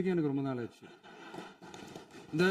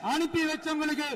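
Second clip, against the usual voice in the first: high-pitched mocking questions; taunting the angry opposition benches; 9 semitones higher, 3 dB quieter than usual. Real, not acted. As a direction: needle the opposition for wailing. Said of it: "கொஞ்சம் சவுண்டா பேசுறேன்".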